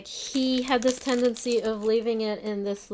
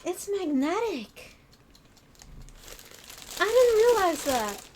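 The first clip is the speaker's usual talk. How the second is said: surprised; 9 semitones higher than usual. Real, not acted. Discovers the item is magnetic.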